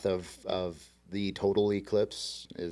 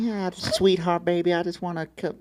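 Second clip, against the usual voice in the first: much higher hammed-up sweet Southern voice; a clumsy, cartoonish cowgirl impression; a botched cowgirl greeting; bad Southern cowgirl; 8 semitones higher than usual. Shown as a demonstration.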